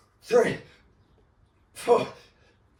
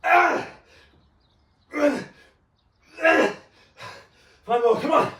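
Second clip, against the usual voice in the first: psyching myself up; 14 semitones higher than usual. Real, not acted. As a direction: hype yourself up mid-set. Pushing through set.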